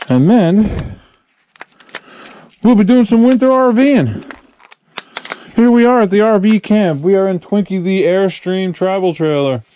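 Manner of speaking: high, loud and energetic; bright welcome to viewers; upbeat vlog intro. Acted.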